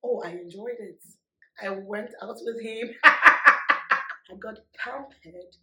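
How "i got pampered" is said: very loud, slow laughing words. Real, not acted. Laughing as she tells viewers she got pampered. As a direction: laugh through it, drawn out.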